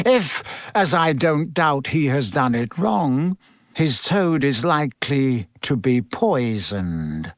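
deliberate announcement, slowing into the threat; Snape, menacing teacher. Acted. Snape predicts the toad's fate, coldly menacing. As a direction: savour the threat before the class.

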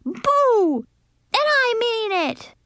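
high, insistent scare shout; child's high threatening cry. A narrator's reading. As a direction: shout high, meaning business. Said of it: "I shouted".

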